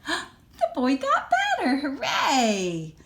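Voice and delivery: high, loud laughing cheer; joyful laughing shout; gasping laugh of joy. Real, not acted. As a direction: burst out in delight. Storyteller celebrates recovery.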